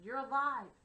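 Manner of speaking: loud, high shout of relief. A narrator's reading. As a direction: shout it out, overjoyed. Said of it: "I shouted".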